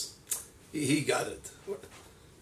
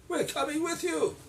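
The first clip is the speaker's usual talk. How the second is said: put-on much higher, pleading young woman's voice; young women; 12 semitones higher, 4 dB quieter than usual. Daughters-in-law pleading with Naomi, high voice. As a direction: insist sweetly.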